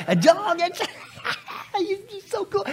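high, breathless delight; excited gushing. Acted. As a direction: gush like a kid. Gushing over dogs.

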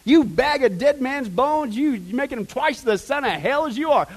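high, loud, drawn-out condemnation; loud rebuke. Retold or imitated. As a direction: hurl the accusation as an angry preacher. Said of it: "He got loud".

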